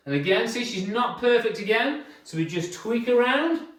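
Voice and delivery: ordinary talk, nothing marked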